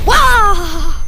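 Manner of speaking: sudden loud wah; loud exclamation. Acted. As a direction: burst out with a yell. Explosion countdown, loud cry.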